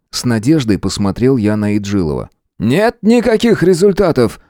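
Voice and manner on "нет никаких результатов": raised-pitch irritated retort; barked. A narrator's reading. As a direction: snap back with moderate force. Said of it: "рявкнул он". (раздражённо рявкнуть)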